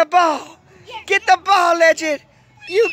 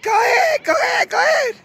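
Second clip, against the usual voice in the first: excited cheering; 8 semitones higher, faster than usual. Real, not acted. Grandma cheering kids at basketball.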